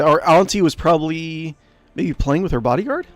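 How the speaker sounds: ordinary delivery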